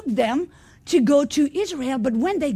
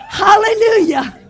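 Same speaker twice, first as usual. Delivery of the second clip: shouted hallelujah; 5 semitones higher, 6 dB louder than usual. Real, not acted. Preacher exclaims after laughter.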